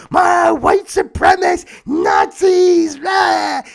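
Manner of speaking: high, loud hysterical shrieking; over-the-top mocking yell; crazed mock outrage. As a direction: screech it like an outraged mob, drawn out. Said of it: "how crazy society will go".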